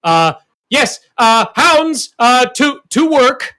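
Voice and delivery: loud, halting creature speech, climbing high at the end; villainous monster voice. Acted. Wounded beast threatens heroine and orders hounds, loud halting monster voice. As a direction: force it out loudly in broken phrases. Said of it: "And he's like".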